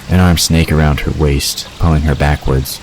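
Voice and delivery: ordinary talk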